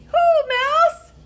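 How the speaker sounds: high, loud squeaky hare voice; excited high hare greeting. Acted. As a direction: pop up high as a surprised little hare. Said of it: "says the hare".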